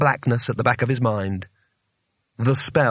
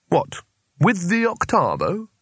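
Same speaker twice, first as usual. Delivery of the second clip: put-on old wizard voice; 7 semitones higher, slower than usual. A narrator's reading. Wizard behind door.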